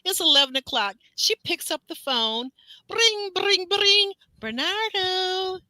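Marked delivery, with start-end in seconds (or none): 2.9-4.1 high, sung phone ring bring bring; mock phone ring. Imitating the phone ringing for the story. 4.4-5.6 mock sad. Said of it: "she's sounding all sad".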